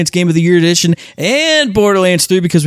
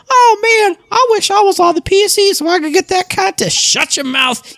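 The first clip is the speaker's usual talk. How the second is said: whiny gamer. Retold or imitated. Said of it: "sitting there and going".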